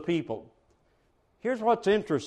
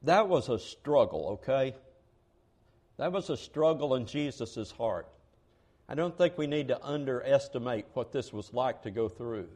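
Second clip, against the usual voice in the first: hushed solemn; 7 semitones lower, 8 dB quieter than usual. Real, not acted. Solemn moment on Gethsemane struggle.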